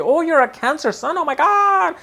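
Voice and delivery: high, gushing mock voice of people fussing over a Cancer; gushing mock fan. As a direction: coo it up high like a starstruck gossip. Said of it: "people keep telling you".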